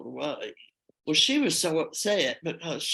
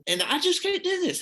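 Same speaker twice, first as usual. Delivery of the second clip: cracking much higher on "just can't do this"; voice breaking; 13 semitones higher, 3 dB louder than usual. Retold or imitated. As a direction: let the voice break up high on "just". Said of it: "was just crying".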